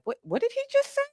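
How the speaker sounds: replaying the moment the nickname landed, baffled; stunned disbelief. Recalling her first nickname, she mimics her own stunned disbelief. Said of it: "I was like".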